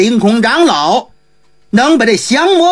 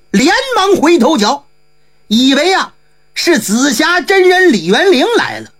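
usual speaking voice

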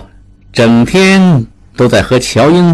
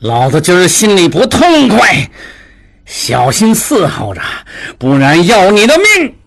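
put-on drunken menacing yell. A narrator's reading. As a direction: threaten like an ill-tempered drunk. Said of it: "正掐住小彩虹的喉咙叫喊". (醉醺醺地恶声威胁)

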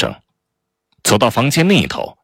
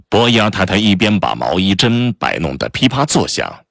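ordinary delivery

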